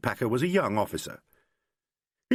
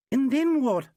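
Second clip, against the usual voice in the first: put-on eager girlfriend; 4 dB louder, slower than usual. A narrator's reading. Girlfriend voiced, much higher.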